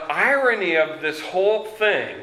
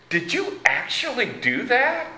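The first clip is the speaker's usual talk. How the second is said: incredulous, much higher pitch; inanamama; 8 semitones higher than usual. Acted. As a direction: ask it like you can't believe it. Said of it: "they can't believe it".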